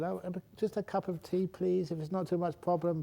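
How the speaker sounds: trying polite. Retold or imitated. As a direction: lift the voice sweetly and ask nicely. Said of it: "being trying polite".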